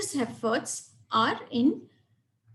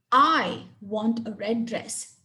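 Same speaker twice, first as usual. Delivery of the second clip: "I" stressed, slow and deliberate; 2 semitones lower, slower than usual. Shown as a demonstration. Teacher showing stress on "I" to the class. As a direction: lean hard on "I" as if you matter most. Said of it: "Clearly, I am really important".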